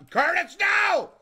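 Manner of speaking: high, drawn-out cry of dismay; alarmed shout. Real, not acted. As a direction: yell to stop him. Friend's action goes wrong.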